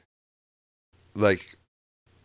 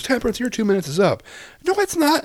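much higher, whiny sibling squabble; mock kid bickering. Brother and sister fighting over the cart, whiny mock voices. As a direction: play both kids sniping at each other.

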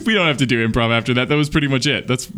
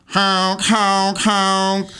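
high car horn honks; silly clown honking; 9 semitones higher, much slower than usual. Acted. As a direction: honk like a goofy car. S0 voices the Buick car.

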